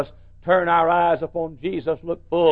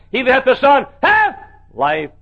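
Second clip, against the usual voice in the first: louder verse; 9 semitones higher, 6 dB louder than usual. Retold or imitated. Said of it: "and I said".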